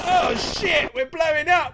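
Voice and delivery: real high, loud burst of surprise; excited shout. Real, not acted. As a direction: yell out as the raid hits. Reacting to a sudden stream raid.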